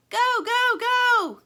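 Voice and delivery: very high, loud cheering yell; yelling encouragement. Acted. Plastic cheers Lumpy into the blizzard, yelling. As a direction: cheer him on at the top of your voice. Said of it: "yells Plastic".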